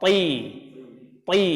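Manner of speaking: ti said with a thick emphatic t; ta demo. Shown as a demonstration. Teacher models the ta syllable for students. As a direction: press the tongue to the palate on ti. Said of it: "Huruf ta".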